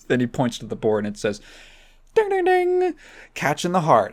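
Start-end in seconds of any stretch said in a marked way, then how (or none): 2.1-4.1 sing-song, very high ding ding; Steve Harvey voice. Acted. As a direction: chime it out like a game-show bell. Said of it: "And then he goes".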